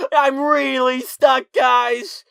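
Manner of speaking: high, loud, drawn-out plea; panicked wail. Retold or imitated. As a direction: wail for help with REALLY stretched out. Re-enacting panicked cry for help.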